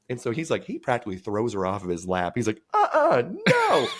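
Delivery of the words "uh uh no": high, loud shriek of no; panicked refusal. Retold or imitated. Buddy throwing the woman off his lap, shrieking no. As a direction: yelp it out in alarm.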